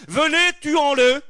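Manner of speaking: high, slow, loud call to kill; rally the crowd to murder; mob call. Acted. Preacher voicing a mob calling to kill, high and slow.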